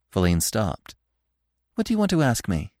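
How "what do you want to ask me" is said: higher-pitched curious question. A narrator's reading. Feline stopping to question Bambi, curious. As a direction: ask with open curiosity. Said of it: "she inquired curiously".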